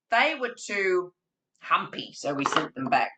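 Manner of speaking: lively read-aloud for little ones; reading to children. Acted. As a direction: tell it like storytime with small kids. Reading Dear Zoo to children.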